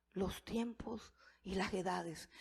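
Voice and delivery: hushed whisper, breath only. Shown as a demonstration. Preacher echoing Daniel's prayer to congregation, whispered reverently. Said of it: "repeats prayer line, then whispers it".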